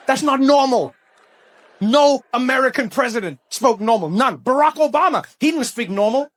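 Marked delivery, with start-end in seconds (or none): none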